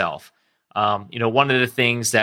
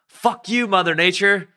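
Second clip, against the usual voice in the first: much higher, mock-furious outburst at nature; angry curse; 10 semitones higher than usual. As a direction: hurl it at the ocean, half in jest. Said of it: "then the anger starts kicking in".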